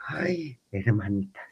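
breathy whispered "ay, hermanitas"; hushed dismay. Retold or imitated. Storyteller to her friends, whispering in dismay. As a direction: drop to a near whisper of dismay.

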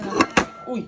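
sudden high startled cry; startled yelp. Real, not acted. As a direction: jump with a startled gasp. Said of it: "ตกใจ".

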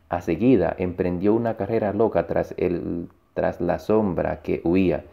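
usual speaking voice

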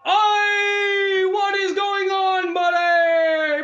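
loud, slow shouted greeting; shouting. Real, not acted. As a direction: call out big and loud.

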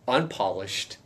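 ordinary talk, nothing marked

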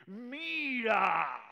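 high, loud shout; defiant shout. Acted. Boss proving he holds his farts, high loud defiant shout. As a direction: bellow it as a challenge.

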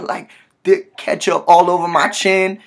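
normal talking voice

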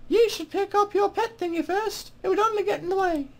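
high-pitched mock British woman; female-voiced British accent. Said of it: "my horrible female voiced British accent".

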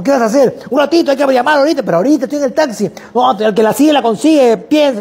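put-on high, rushed, overeager young salesman; eager salesman. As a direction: pitch the shared taxi like a pushy go-getter. Student rushing out to call.

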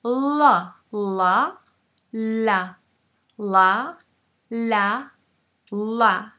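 "la" with the tongue pressed on the palate; clear l. Shown as a demonstration. Teacher showing a clear English l. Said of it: "lengua contra el paladar".

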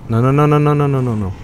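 normal talking voice

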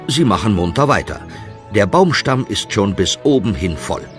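ordinary talk

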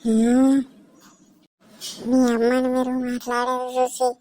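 slow, eerie drawl with stretched vowels; feigned possession. Pretend. Mother pretending to be possessed threatens son, slow ghostly drawl. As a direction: draw every phrase out like a menacing spirit. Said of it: "మీ అమ్మకు దయ్యమే పట్టింది".

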